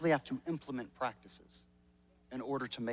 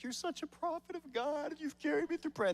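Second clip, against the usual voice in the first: higher, lightly sweet-talking compliment; a hint of gushing over his wife; higher mock praise on a good day; 12 semitones higher than usual. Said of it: "it'll be like".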